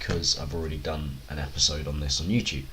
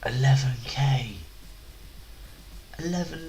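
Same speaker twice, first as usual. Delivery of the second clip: awed whisper; 6 semitones higher than usual. Real, not acted. Awed at big donation.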